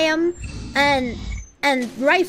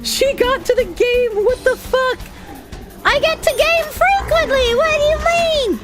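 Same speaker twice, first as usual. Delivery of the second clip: indignant outburst; 9 semitones higher than usual. Real, not acted. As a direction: squeal of indignation.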